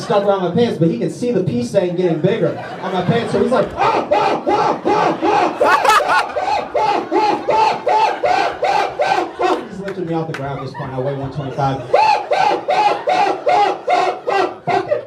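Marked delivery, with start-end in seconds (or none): 3.7-9.7 very high, hammed-up short screams; mock shrieks in a steady rhythm; repeated panicked yelps. Imitates mugger with hand stuck. 11.8-15.0 very high, hammed-up short screams; mock shrieks in a steady rhythm; repeated panicked yelps. Resumes imitating the mugger.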